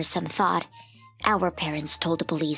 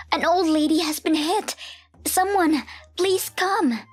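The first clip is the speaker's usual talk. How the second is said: high, loud cry for help; child yelling in alarm for someone to come; urgent raised-voice shout; yelled out; 11 semitones higher, 4 dB louder than usual. A narrator's reading. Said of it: "So we yelled out".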